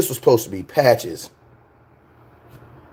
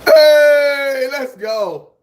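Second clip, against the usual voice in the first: excited shout; 10 semitones higher, much slower than usual. Real, not acted. Streamer thanking auntie for the gift, cheering. Thrilled by throat drops.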